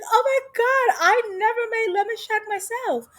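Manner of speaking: high-pitched, excited gushing; mock-amazed high voice; gushing amazement. Acted gushing guest.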